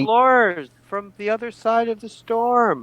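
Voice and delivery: high, drawn-out hail across the water; high-pitched call into the distance. Acted. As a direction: announce yourselves to someone far away. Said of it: "We could call out".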